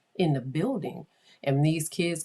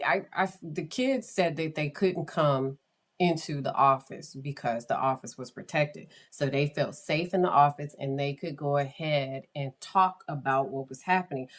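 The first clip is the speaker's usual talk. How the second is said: ordinary delivery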